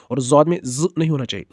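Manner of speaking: ordinary delivery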